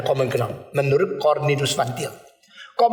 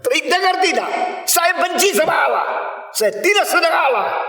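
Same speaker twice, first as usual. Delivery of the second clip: yelling; 12 semitones higher than usual. Retold or imitated. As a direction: shout it so the silent pastor must hear. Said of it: "Kalau dia teriak-teriak".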